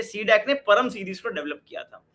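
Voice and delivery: ordinary talk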